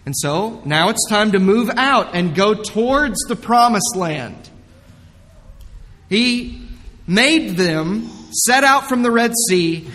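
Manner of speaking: ordinary talk, nothing marked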